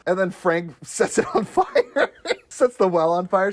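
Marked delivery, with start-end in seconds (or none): none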